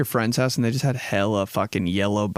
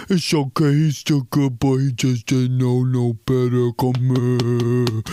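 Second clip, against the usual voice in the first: doting owner; 4 dB louder, slower than usual. Retold or imitated. Dog owner excusing the mess to the office, louder cooing voice. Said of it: "would be like".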